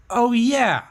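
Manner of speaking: light up as it clicks; high loud eureka cry; excited realization. Retold or imitated. Realizing why he does it.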